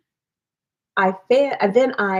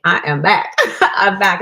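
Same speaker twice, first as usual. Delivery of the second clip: louder, laughing as she repeats it; laughing triumph; 5 dB louder, slower than usual. Real, not acted. Laughing to her live viewers about being back. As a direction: crow it out with a laugh.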